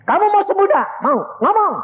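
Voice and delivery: high, loud confrontation; shouted demand. Retold or imitated. Preacher ordering a sick man to speak, shouted demand. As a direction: demand an answer at full voice.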